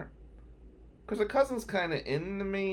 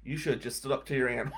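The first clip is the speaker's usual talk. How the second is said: lower, softer, amused quote; laughing friend; 4 semitones lower, 6 dB quieter, faster than usual. Retold or imitated. Friend laughing at his fear of his aunt, laughing. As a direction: imitate a friend talking through a chuckle. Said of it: "he's laughing".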